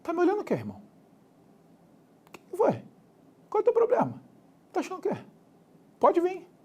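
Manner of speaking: high-pitched cocky street challenge; suburban tough guy. Acted. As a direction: play a suburban guy picking a fight. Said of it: "venho lá do subúrbio, eu já imagino quase assim".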